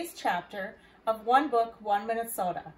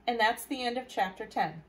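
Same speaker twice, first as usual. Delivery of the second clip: plain reading voice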